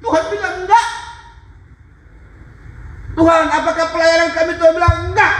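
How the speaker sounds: high, intense pulpit storytelling; impassioned preaching. Real, not acted. Preacher retelling his wife's dialogue with God, impassioned. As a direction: cry out the dialogue at a sustained high pitch.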